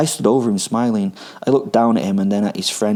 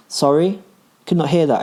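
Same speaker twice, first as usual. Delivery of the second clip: raised, high-pitched challenge; mild yell; 7 semitones higher, 3 dB louder than usual. A narrator's reading. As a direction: demand a repeat, voice pitched up. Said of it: "I yelled".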